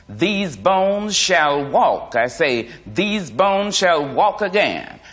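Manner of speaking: slow, high, loud preaching boom; booming preacher. Acted. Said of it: "the minister's voice boomed".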